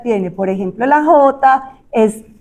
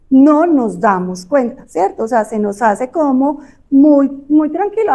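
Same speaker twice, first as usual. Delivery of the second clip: ordinary talk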